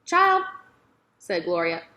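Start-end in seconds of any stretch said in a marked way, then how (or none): 0.1-0.4 put-on high, louder drawn-out "Child"; patient old woman calling out; old woman's voice. A narrator's reading. Gloria addresses the girl again.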